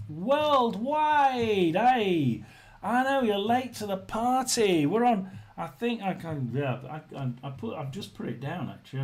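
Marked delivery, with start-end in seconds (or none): none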